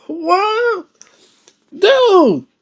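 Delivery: high, stunned cry of disbelief; shocked disbelief. Real, not acted. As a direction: shriek out the shock. Reactor shocked at story.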